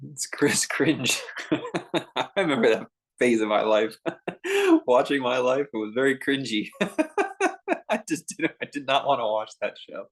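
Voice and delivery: real laughter breaking through the words; laughing. Real, not acted. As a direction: giggle helplessly while recalling your own cringe. Laughing over own cringy past.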